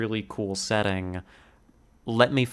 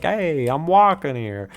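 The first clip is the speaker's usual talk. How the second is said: higher-pitched, hammed-up New York accent; 5 semitones higher, 4 dB louder than usual. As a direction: do a cartoon New Yorker calling out. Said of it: "the stereotypical New York accent".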